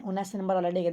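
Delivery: usual speaking voice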